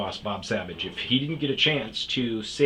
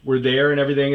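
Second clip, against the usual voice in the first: louder, higher excited telling; amused disbelief; 5 semitones higher, 8 dB louder than usual. Real, not acted. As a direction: still laughing it off.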